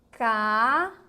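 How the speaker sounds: "Camada" drawn out as ca... ma... da; syllable by syllable. Shown as a demonstration. Teacher offering another example word, "Camada" slowly split into syllables. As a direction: stretch and separate each syllable of "Camada". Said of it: "vamos pensar assim".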